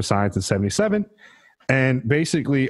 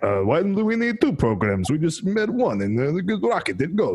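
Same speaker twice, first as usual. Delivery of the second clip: put-on Russian accent; 5 semitones higher than usual. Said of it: "Never do that accent again".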